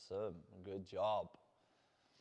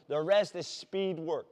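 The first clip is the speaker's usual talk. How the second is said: usual speaking voice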